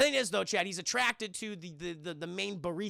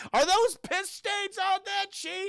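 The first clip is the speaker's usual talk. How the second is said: high, drawn-out jeer; mocking heckle; 11 semitones higher, slower than usual. Heckler mocking Hubie from the front.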